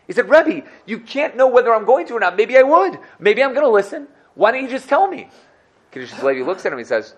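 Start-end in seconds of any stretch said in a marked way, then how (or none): 0.3-5.3 high, loud insistent plea; much higher, louder begging; insistent pleading. Acted. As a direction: push hard to be told. Manager insisting on being told.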